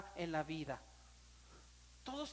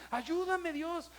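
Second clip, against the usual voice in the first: retold cry for help; 7 semitones higher, 3 dB louder, much slower than usual. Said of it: "de veras era esa expresión".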